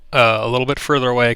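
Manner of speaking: ordinary delivery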